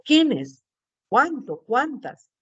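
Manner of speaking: each word starts high and falls; exclamatory tone. Shown as a demonstration. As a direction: exclaim "quiénes", "Cuánto", "Cuántas" one by one. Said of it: "pronombres exclamativos". (palabras exclamadas, agudas y descendentes)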